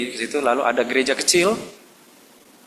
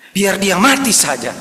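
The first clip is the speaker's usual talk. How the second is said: slow, louder, higher pleading; exasperated prayer, slow and loud; mock fed-up prayer; 6 semitones higher, 5 dB louder, slower than usual. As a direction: drag it out like an exasperated plea. Fed-up congregation praying.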